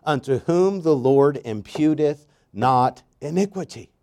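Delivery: emphatic pulpit delivery, slowed; fervent preaching. Acted. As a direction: preach it slow and strong to the congregation. Preaching at full force.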